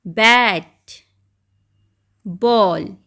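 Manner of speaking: each word said loud and separate, with a pause after it; loud, slow phonics drill; phonics word reading. Shown as a demonstration. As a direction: call out each word clearly for the class. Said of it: "तो शुरू में क्या sound आ रहा है".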